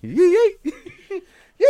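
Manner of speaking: very high, loud squeal of delight; happy old customer. Retold or imitated. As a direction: cheer like a thrilled old-timer leaving the store. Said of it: "they walk out the store going".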